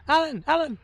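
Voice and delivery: high, loud cartoonish call; high meerkat yell for Alan. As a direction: shout the name like a frantic meerkat. Imitating meerkat calling out Alan.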